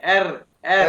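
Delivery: एर, एर with the tongue vibrating, a loud rolled r. Shown as a demonstration. Teacher correcting the student's r, loudly trilled. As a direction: trill the r hard, twice, for the student. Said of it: "जबान को vibrate कर".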